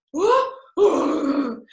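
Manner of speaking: a big theatrical gasp; mock dramatic inhale; loud gasp. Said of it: "he's being so weird".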